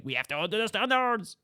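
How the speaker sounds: put-on high-pitched, over-the-top French accent; intense French accent. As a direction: play an unyielding French inspector, worked up. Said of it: "he was being really intense about it".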